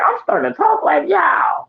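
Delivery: high, slow, shouted realization; wife's surprised exclaim. Retold or imitated. Wife noticing her new accent, high shouted surprise. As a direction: call it out high and amazed. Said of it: "It was like".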